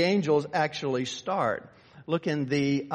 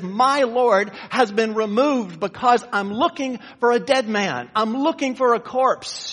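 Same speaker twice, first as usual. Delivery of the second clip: Mary's lament; 5 semitones higher, 8 dB louder than usual. Acted. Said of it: "Mary says".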